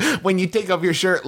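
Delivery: ordinary talk, nothing marked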